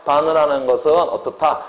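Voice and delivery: ordinary talk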